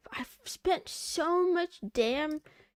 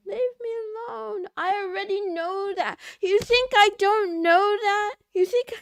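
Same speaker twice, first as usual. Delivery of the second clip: loud, higher, fake-wounded yelling; mock tantrum; 5 semitones higher, 9 dB louder than usual. To chat bullying her, loud mock-hurt tantrum.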